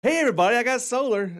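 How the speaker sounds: high, loud mock cheer; excited. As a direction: gush like a thrilled show-off. Mock boasting about solar.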